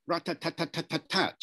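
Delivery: each "tat" given equal weight; syllable-timed rhythm. Shown as a demonstration. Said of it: "rhythm is now much more rat-tat-tat".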